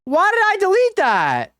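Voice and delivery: high, startled squeal of disbelief; shocked by his own choice; panicked cry. Real, not acted.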